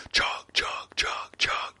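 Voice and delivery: breathy unvoiced chugging noise; soft imitated kitchen noise; whispered chug chug. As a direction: whisper the chugs like a busy kitchen. Imitating cooking sounds.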